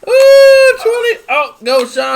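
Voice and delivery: high, loud excited yelling. Real, not acted. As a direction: yell high in excitement at the spray. Excited yelling at shaken bottle.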